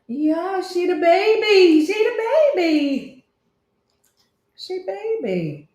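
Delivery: high, drawn-out cooing. Real, not acted. Fussing over the baby, high cooing baby talk. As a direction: coo slowly in a high pet-talk voice.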